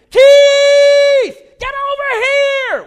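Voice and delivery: very high, full-force scream; screaming for help. Retold or imitated. Drowning man calling his friend in the boat, screaming. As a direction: scream across the water to a friend, drawn out. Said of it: "I started screaming".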